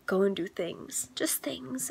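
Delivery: hushed, slow whisper. Real, not acted. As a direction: breathe it out in a whisper. Whispered excitement about doing things.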